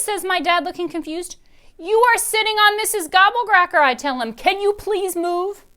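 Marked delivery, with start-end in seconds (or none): 1.8-5.6 put-on high, loud pleading child; a little girl begging her dad to move; Dory voice. A narrator's reading. Dory tells her dad to get up, high and loud.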